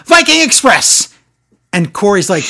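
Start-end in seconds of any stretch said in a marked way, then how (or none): 0.1-1.1 high, loud commentator yell; mimicked ringside holler; imitated yell. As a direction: shout it like an over-excited announcer. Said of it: "he yells".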